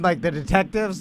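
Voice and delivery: ordinary talk, nothing marked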